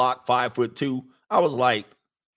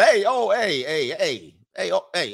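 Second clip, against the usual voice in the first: mock alarmed calling out; 4 semitones higher than usual. Calling out to imagined maid.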